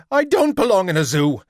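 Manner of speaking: high, loud, fast and indignant; a small ape protesting shrilly; talking monkey squealing his outrage; shrill monkey voice. A narrator's reading. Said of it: "he shrilled".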